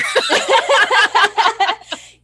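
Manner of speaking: a burst of high delighted laughter; loud high laugh. Real, not acted. Host laughing at her own plan to interview the guest, bursting into high laughter.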